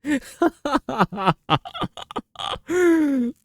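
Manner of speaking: long, high cackling laugh; real, helpless laughter; laughing hard. Real, not acted. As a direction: lose it completely. Cracks up at own impression.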